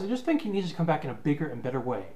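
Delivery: usual speaking voice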